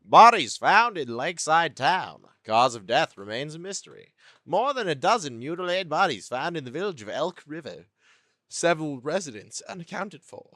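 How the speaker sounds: exaggerated swooping newsreader delivery; news read. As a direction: read headlines like an over-the-top news announcer. Reads game newspaper headlines aloud.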